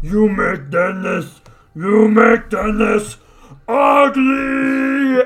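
slow monster accusation. Acted.

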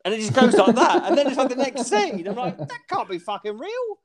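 high-pitched, talking through laughter; incredulous laughter. Real, not acted. Friend mocking the cut-off scene, laughing in disbelief. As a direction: laugh helplessly while protesting in a high voice.

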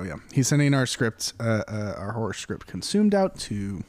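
normal talking voice

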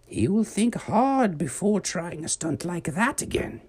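put-on old witch voice. Miss Needles warning the children, high old witch voice. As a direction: speak as the wise old witch.

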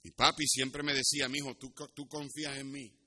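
ordinary delivery

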